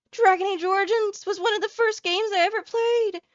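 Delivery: high loud outraged fan rant; angry rant. Real, not acted. Longtime fan yells at the trailer, high outraged shouting. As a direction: yell your disbelief high and loud. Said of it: "I'm angry today".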